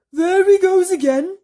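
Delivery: shrill-free high worm voice; earthworm voice. Acted. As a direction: pitch way up for the worm. Earthworm character voice.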